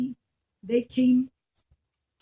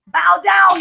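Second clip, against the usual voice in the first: loud barked order; king's command; 6 semitones higher, 12 dB louder than usual. Acted. King ordering the next son to bow, loud. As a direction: bark it down at the boy.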